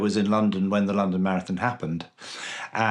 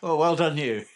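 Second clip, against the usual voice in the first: usual speaking voice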